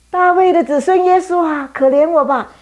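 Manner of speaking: high, loud pleading call; loud cry. Acted. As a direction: shout across the crowd for mercy. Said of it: "他叫得很大声".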